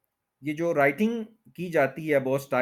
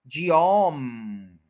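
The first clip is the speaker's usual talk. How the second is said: जियोम as jee-AWMMM, very drawn out; full-energy stress; 3 dB louder, much slower than usual. Shown as a demonstration. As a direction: stretch the AWM of जियोम slowly. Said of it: "पूरा आपने energy देनी है".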